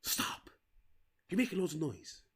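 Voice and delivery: whispering to dad to shut up in the cinema; hushed whisper. Retold or imitated. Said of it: "The daughter was like".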